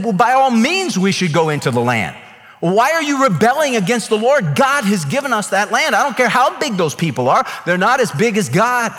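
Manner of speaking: rally the crowd, pressing hard; urgent plea. Retold or imitated. Joshua and Caleb pleading boldly.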